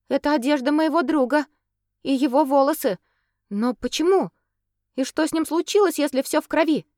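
high, alarmed, pleading questions; frightened girl demanding answers; испуганно. Acted. Katerina frightened by blood. (испуганная девушка)

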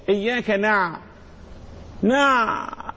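"نع" stopped on a long voiced pharyngeal ع; ayn resonance. Shown as a demonstration. Teacher showing the sound called ba'ba'a, held ayn. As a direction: hold the throaty ع at the end of "نع". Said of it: "الصوت ده يسمى".

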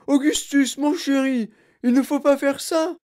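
high, loud, quick scolding mother's voice; anxious mother crying Augustus, chéri; fretting mother. A narrator's reading. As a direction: fuss at the boy, alarmed. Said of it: "cria madame Gloop".

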